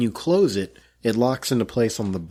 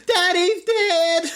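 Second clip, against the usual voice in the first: much higher, very slow, hammed-up kid voice; mock child wail; much slower than usual. Husband mock-voicing a child wailing over him, high and slow.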